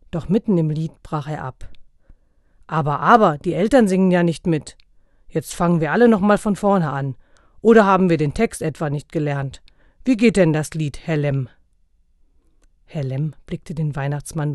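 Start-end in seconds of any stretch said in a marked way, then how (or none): 2.7-11.5 loud, stern rebuke; scolding Santa. A narrator's reading. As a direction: scold the parents like a strict teacher.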